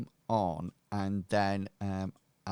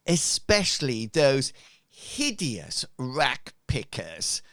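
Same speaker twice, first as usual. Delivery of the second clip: sneering leader; 3 semitones higher, 6 dB louder than usual. Acted. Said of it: "And he goes on to say".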